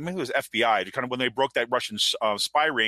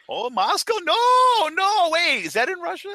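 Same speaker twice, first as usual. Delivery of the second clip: high, loud hammed-up confusion; mock dumb denial; 6 dB louder, slower than usual. Mocking Page pretending not to know Moscow, high and loud. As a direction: play dumb as loud as you can.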